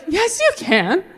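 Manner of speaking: high, rising daughter's voice; pleading daughter. Retold or imitated. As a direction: insist to dad in a high daughterly voice. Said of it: "And as his daughter".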